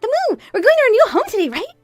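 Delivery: put-on very high, loud child voice; excited little son. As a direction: burst out eagerly like a small boy. Excited son asks about moving.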